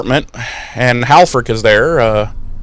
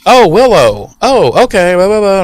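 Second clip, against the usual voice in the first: higher, louder, breezy phone chatter; chirpy Buffy; 8 semitones higher, 3 dB louder than usual. Retold or imitated. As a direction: rattle it off lightly. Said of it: "She's like".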